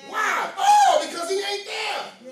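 high, loud, slowed preacher's cry; preaching shout. Acted. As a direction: throw the question up to the rafters. Preacher mocks disciples' fear.

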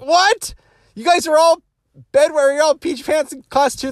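high, loud astonished squeal; shocked disbelief. Retold or imitated. Shocked at classmates who also wet their pants. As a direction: recoil in disbelief at the news.

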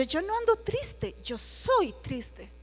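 proud. Retold or imitated. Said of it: "Muy orgullosa".

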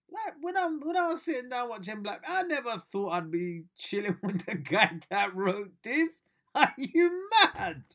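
high, loud disbelieving gush; giddy excitement. Real, not acted. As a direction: squeal with delight. Gassed about working with Brooker.

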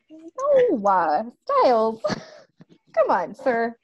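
high, amused, laughing "no"; laughing protest. Real, not acted. Cohost laughing at Stiles being asked about drugs. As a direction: groan it through laughter. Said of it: "amused protest at the scene".

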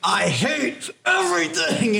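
high, drawn-out mock whine of hatred; mock misery. Recalling their July mood to co-hosts, mock-miserable. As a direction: slowly wail it out in mock despair.

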